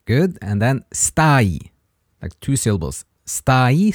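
slow, syllable by syllable, for learners; a teacher slowing down so a learner can copy; slow repeat-after-me. Shown as a demonstration. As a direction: spell the Italian out slowly for a student. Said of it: "try to repeat these three words".

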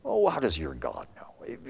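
low-pitched derisive scoff; mocking. Preacher as the world jeering believers, mocking. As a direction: make fun of it in a low voice. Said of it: "is making fun of the God".

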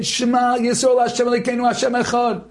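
high chanted cry of the prayer; retold scream. Religious soldier repeating Shema in battle, high cry. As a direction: keep crying it out over and over. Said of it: "he's screaming over and over again".

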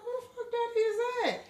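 high, quick, laughing hushed gasp; teacher spotting a fine dad; mock thirsty exclaim. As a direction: blurt it out, impressed and giggling. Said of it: "I was like".

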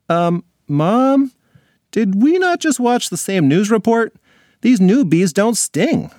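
put-on high, incredulous young girl; a kid pointing out the obvious. Alex questions mom.

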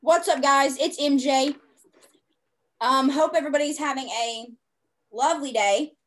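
upbeat announcer voice, loud and bright; like a radio DJ opening the show; radio host voice. Real, not acted. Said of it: "I sound like a radio host, announcer".

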